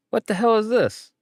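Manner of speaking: high, sharp mock outrage; mom's angry question. Mother walking in on the sleeping child, mock angry. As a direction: play the mother catching him out. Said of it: "his mom walks in".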